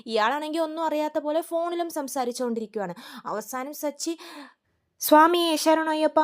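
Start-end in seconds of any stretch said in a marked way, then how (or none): 5.0-6.2 loud, slow yelp of a prayer; pained outcry. Retold or imitated. Sachi leaping up from his crushed foot, loud slow cry. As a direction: burst out as the pain finally wins.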